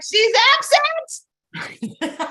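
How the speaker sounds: very high, very slow sing-song lie; child's fake innocent lie. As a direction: stretch it out sweetly to fool her. Child lying to send lady away.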